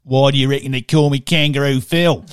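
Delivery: loud rural Australian impression; broad Aussie retort, hammed-up; Kangaroo Phil. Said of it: "we're talking kangaroo".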